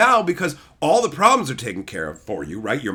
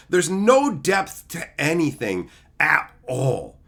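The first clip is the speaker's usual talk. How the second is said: ordinary delivery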